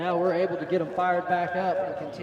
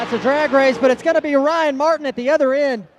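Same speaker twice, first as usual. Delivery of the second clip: excited race call; 8 semitones higher, 9 dB louder, faster than usual. Real, not acted. Announcer calling a close finish.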